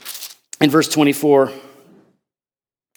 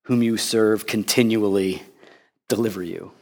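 hushed sorrowful king; 8 semitones lower, 7 dB quieter than usual. Acted. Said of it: "The king declared to Daniel".

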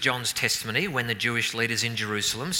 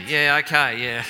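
laughing; 3 semitones higher, 7 dB louder than usual. Real, not acted. Teasing congregant about birthday.

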